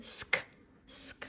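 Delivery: "sk" said alone, each time as one joined sound; repeated blend. Shown as a demonstration. Teacher drilling the blend with a child, joined sounds. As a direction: repeat "sk" with a short pause between. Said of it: "together it says sk".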